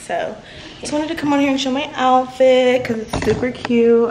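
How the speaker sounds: normal talking voice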